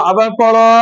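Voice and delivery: ordinary talk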